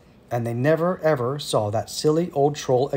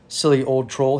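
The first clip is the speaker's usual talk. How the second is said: plain reading voice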